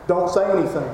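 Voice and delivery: normal talking voice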